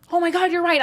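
high-pitched, excited retold gasp of agreement; sudden realisation. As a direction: blurt it as it suddenly dawns on you. Acts out her sudden realisation.

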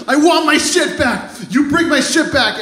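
high, strained demand; acted high-pitched outrage; upset demand. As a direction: imitate a worked-up boss insisting. Boss confronts thief after crying.

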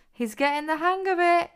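excited, high-pitched approval; delighted cheer. A narrator's reading. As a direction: applaud their progress with a lifted voice. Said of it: "clapped Olivia".

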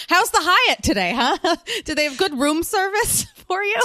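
playful mock interviewer; teasing. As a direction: rib her about the hotel. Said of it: "your backdrop is like".

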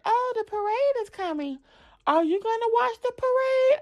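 put-on high, light little-girl voice; daughter's voice. Mom retelling her daughter asking the little girl, high child voice. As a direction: voice the eager young daughter.

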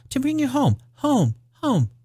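put-on very high, soft, gleeful child voice; little girl. A narrator's reading. Little sister telling her brother he's coming home, gleeful. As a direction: squeal it softly like a delighted little sister. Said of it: "bending down to laugh".

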